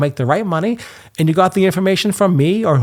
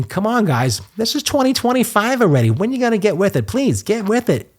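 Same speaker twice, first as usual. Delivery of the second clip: exasperated plea; 8 semitones higher than usual. Real, not acted. Ranting at eBay's slowness.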